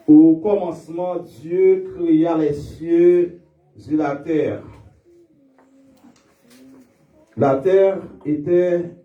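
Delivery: lit l'Écriture lentement, avec solennité; lecture biblique. Acted. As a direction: proclamer le texte sacré à l'assemblée. Lecture de la Genèse. (lecture solennelle de la Bible)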